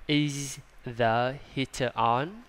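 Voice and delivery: slow, rising Is the heater on; slow model reading. Shown as a demonstration. Teacher giving a slow model question. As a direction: read it slowly and lift the end. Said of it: "Nè".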